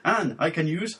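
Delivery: "Anne, I can use" rising sharply on "use"; upward question inflection. Shown as a demonstration. Host showing co-host the question mark, "use" swung upward. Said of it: "giving it an upward inflection".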